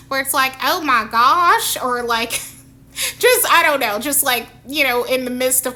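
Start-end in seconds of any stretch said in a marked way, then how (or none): 0.6-1.8 slow, loud country drawl; country accent. Shown as a demonstration. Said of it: "slip into is a country accent".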